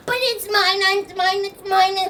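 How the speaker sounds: put-on high, whining child protest; sad grandbaby. Grandbaby refused the last box, high pitiful whine. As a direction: plead tearfully, repeating insistently. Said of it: "moved by all this sadness".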